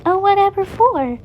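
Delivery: high, sweet chirpy question; sweet chirp. A narrator's reading. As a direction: chirp it innocently. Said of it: "Chica chirped sweetly".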